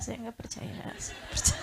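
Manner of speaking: soft whisper to oneself; wife's silent inner whisper. Shown as a demonstration. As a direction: say it only in your heart. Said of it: "dalam hati ya".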